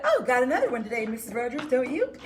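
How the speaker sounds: fast loud gleeful taunt; sucking-up friend. Retold or imitated. The teacher's friend gleefully sucks up to Missus Rogers. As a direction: gush it quickly to flatter. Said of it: "she said".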